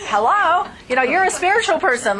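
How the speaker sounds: laughing hello, pointing out the obvious; exasperated. Retold or imitated. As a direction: come on, really?. Exasperated at coach's answer.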